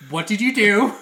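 loud anxious call from below; worried girlfriend. Retold or imitated. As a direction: call upstairs in alarm. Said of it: "I hear".

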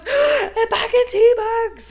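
high, loud, drawn-out exclamation; slow squeal of mock rapture; hammed-up amazement. Finding tea bags in the press, mock-ecstatic cry. Acting out joyful discovery in press.

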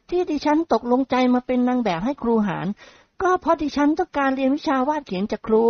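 put-on sweet polite young lady; young woman voice. Young model declines modestly. (เสียงหญิงสาวสุภาพอ่อนหวาน)

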